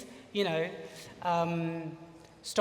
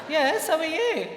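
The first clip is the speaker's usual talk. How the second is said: put-on much higher, laughing American accent; 9 semitones higher, 4 dB louder than usual. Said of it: "he spoke a bit with an American accent".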